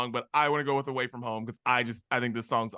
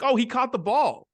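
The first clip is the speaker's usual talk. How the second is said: higher, louder naive cheer; mock excited fan; 9 semitones higher, 6 dB louder than usual. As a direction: exclaim like a clueless new fan. Acting out a clueless first-time viewer.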